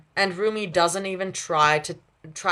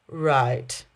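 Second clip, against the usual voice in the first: slow skeptical; 4 semitones lower than usual. A narrator's reading. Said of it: "Mira says slowly".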